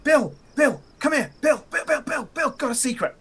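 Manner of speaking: high-pitched, sing-out calling of a name; coaxing call. Acted. [name] calls Bill over with a secret, high coaxing call.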